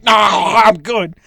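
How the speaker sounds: slow, pushed-out words while still choking; strained after choking. Acted. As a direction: force the words out past the cough. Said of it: "choking and is like".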